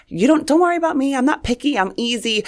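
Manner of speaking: high, breezy voice of the easygoing sibling; carefree fun sister. Retold or imitated. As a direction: toss it off lightly, pitched high. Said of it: "I was, like, the fun one".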